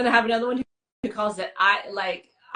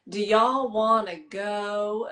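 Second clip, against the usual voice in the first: "y'all" and "go" stretched long in a slow Southern drawl; slower than usual. Retold or imitated. Imitating the Georgia friend's drawn-out Southern invite. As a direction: drag every word out like a Carolina drawl. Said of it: "everything is, like, five seconds long".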